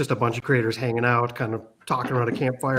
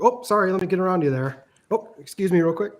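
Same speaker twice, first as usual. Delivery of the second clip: put-on Minnesota accent; 6 semitones higher than usual. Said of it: "we say".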